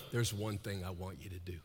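soft, low and intimate; Paul leaning in quietly; hushed. Retold or imitated. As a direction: say it close like a secret. Paul's line, hushed retelling.

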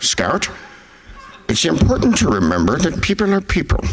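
hammed-up film-star impression, swooping in pitch; Max von Sydow. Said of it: "What if Max von Sydow was in".

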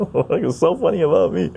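asked through laughter; laughing. Real, not acted. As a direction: laugh your way through the question. Laughing at viewer's joke.

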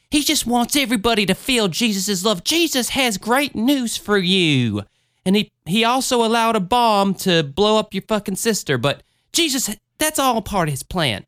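a hint of a mega church pastor; mock preacher. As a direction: mimic a sugary TV preacher. Mocks televangelist Joel Osteen.

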